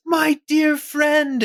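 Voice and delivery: high, drawn-out affectionate hail; gushing greeting. Acted. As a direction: gush over a friend slowly. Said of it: "Ebby runs up and says".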